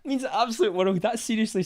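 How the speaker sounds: very high, gushing gratitude; overjoyed thanks. Real, not acted. Streamer thanking Ben for the support, overjoyed. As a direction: gush thanks in a soaring voice.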